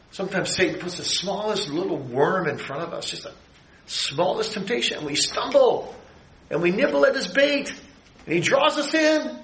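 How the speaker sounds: rising preacher's build on "draws us in"; building sermon. Acted. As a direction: preach it rising toward a peak.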